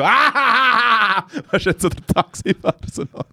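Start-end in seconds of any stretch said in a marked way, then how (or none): 0.0-1.2 high yelp through laughter; laughing imitated shriek; mock scream. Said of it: "dass er die Scheibe vom Taxifahrer hergegangen ist und so".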